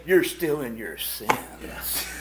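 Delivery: slow, soft mock rebuke; a lightly voiced stern Brother David; mock stern preacher. Imitating Brother David scolding the congregation, slow and soft. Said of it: "here's Brother David".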